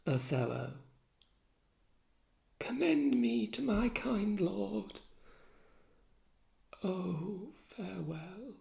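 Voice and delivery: soft fading last breaths; faint dying voice. Acted. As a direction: fade away as you die. Desdemona dying, last words.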